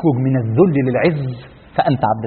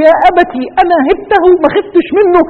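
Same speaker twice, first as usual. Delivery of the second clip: put-on much higher, bright young girl's voice; high-pitched girl telling her father she feared nobody; daughter's voice; 13 semitones higher, 5 dB louder than usual. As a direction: play the proud little daughter answering her father. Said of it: "فبنته تقول له".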